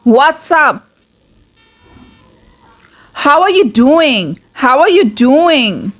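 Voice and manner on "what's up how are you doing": ordinary talk, nothing marked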